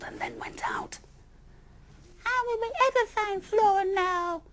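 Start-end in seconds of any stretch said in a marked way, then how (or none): 2.2-4.4 very high loud despairing wail; wailing Missus Rabbit Bun. Acted. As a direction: wail as the mother who lost her baby. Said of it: "wailed Missus Rabbit Bun".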